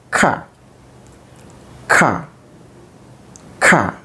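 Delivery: "ka" said as a crisp, short consonant demo, repeated three times; letter sound ka. Shown as a demonstration. Teacher sounding out the letter for pupils, crisp "ka" demo. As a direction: sound out "ka" slowly with a pause after each, like a phonics teacher. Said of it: "so it may be called".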